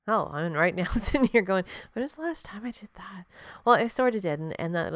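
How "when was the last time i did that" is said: whispered under the breath; a self-questioning whisper; whisper to herself. Real, not acted. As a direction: hush it, as if caught out. Asking herself mid-thought.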